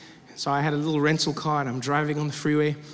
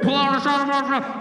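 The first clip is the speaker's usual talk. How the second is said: higher, garbled loudspeaker announcement; muffled, barely intelligible cop orders; irritated police loudspeaker; 7 semitones higher than usual. Retold or imitated. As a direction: run the words together like a cop on a bad speaker. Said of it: "couldn't hear what the hell he was saying".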